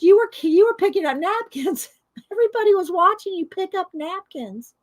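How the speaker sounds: high, amused mock exclamation; teasing quote. Wife retelling husband's teasing over napkins, amused mock disbelief.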